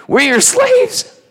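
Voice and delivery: very high, slow, pleading cry of the brothers; groveling brothers. Acted. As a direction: beg like broken men at his feet. Brothers grovel before Joseph.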